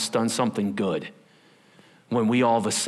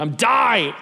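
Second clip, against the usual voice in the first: a hint of a child whining; mock kid whine, louder; whiny kid; 9 semitones higher, 6 dB louder than usual. Child complaining to dad, light mock whine.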